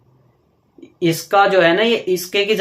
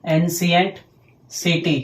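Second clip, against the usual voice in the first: slow dictation; 3 dB quieter, much slower than usual. Shown as a demonstration. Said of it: "spelling out translation".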